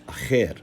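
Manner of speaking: "acher" said slowly as a-KHER; two syllables. Shown as a demonstration. Lecturer showing the masculine Hebrew word, two syllables. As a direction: say "acher" in two beats with rasped kh. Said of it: "word is two syllables".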